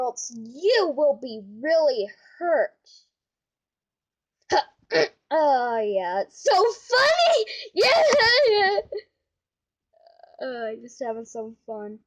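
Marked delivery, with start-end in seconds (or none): 5.3-8.8 high gloating toy crocodile voice; crocodile puppet voice. Acted. Crocodile mocking laugh.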